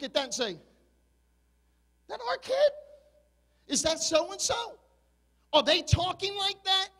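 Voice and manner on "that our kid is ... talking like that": a retold shocked gasp, pitched high; shocked disbelief. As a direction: voice jumps up in mock disbelief. Pastor retells his shocked reaction.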